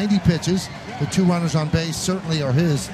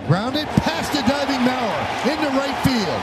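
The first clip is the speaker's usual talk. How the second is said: excited play-by-play; 8 semitones higher than usual. Acted. Live play-by-play call of a hit.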